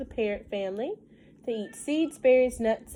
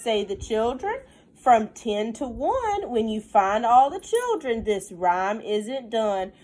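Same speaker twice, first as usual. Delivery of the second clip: lilting storybook read, slowed on the rhymes; reading to children; 2 semitones higher, 6 dB louder than usual. Acted. Teacher reading a counting rhyme to her class, sing-song storybook voice. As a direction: read the rhyme aloud to the little ones. Reads picture book aloud.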